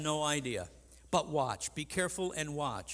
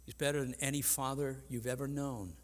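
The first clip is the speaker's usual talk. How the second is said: ordinary delivery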